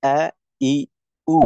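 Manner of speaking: hamza on "a, i, u", each separate; hamza articulation. Shown as a demonstration. Teacher models hamza with three vowels, hamza articulation. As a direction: sound hamza with each vowel, pausing between. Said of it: "তো উচ্চারণটা".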